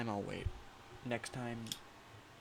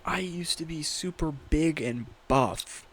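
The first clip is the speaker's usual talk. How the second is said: mock dramatic; 4 semitones higher, 6 dB louder, slower than usual. Mock tale of being buff.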